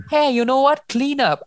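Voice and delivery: high-pitched, mock-bossy command; haughty order. Pearl orders Cinder to clean up, haughty, acted. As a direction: act out a cruel stepsister giving orders.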